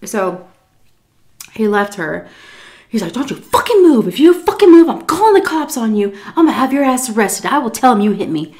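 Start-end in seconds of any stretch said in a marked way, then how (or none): 3.1-8.5 high, loud, fast angry threats; retold furious yelling. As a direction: yell the threats at her, fast. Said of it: "He was so mad".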